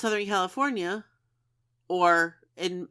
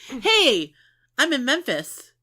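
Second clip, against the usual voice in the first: hammed-up high, loud announcement; over-eager visitor rallying everyone; mock excited tourist; 7 semitones higher, 7 dB louder than usual. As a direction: call out to the whole group in put-on excitement.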